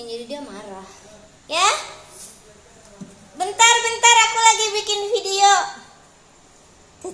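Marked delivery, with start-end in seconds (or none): 1.5-5.5 much louder, higher and fast; 1 loud calling back. Real, not acted. Owner shouting back that she is filming, loud and fast. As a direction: call back across the house quickly. Answering someone from afar.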